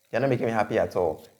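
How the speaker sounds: normal talking voice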